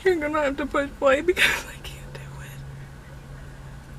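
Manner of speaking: tense breathless whisper. Real, not acted. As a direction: whisper it, too scared to listen. Too nervous to play voicemail.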